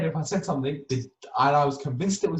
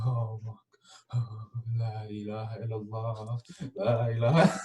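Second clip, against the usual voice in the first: frightened prayer; 4 semitones lower, 4 dB quieter than usual. Retold or imitated. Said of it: "sleeping bag like this".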